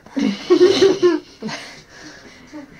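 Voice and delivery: loud burst of helpless laughing; real laughter. Real, not acted. Helper cracking up at a violent-sounding kitten, real laughter. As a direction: crack up uncontrollably, loudly. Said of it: "I'm sorry I started laughing".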